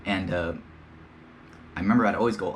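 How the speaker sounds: usual speaking voice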